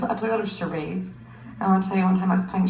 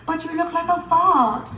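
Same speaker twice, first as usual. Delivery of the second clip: put-on kid's taunt; 8 semitones higher than usual.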